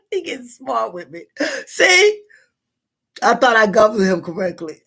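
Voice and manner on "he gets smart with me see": real amusement, words squeezed through laughter; laughing while talking. Real, not acted. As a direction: talk through giggles about a cheeky son. Laughing about son's teasing comment.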